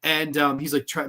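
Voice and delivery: ordinary talk, nothing marked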